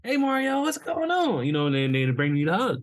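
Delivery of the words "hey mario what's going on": much higher, bright greeting; imitating cheerful coworkers. Coworkers greeting Mario, much higher and cheery. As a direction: sing out a friendly hello. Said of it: "they're already coming in like".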